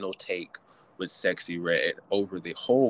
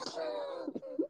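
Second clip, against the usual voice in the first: high shrieking laugh; 13 dB quieter than usual. Real, not acted. After mocking quote.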